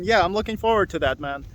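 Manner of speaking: normal talking voice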